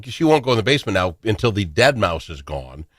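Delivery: normal talking voice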